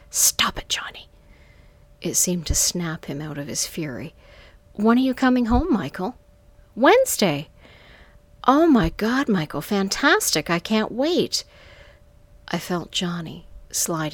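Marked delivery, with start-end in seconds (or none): 0.1-1.1 hissed under the breath in anger; angry hiss. A narrator's reading. Woman covering the phone to scold Johnny, angry hiss. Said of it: "hissed angrily". 4.7-11.4 high, loud, delighted phone voice; excited. A narrator's reading. Woman hearing Michael is coming home, excited.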